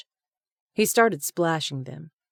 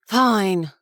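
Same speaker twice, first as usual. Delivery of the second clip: huffy; 5 semitones higher, 8 dB louder than usual. A narrator's reading. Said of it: "he huffed".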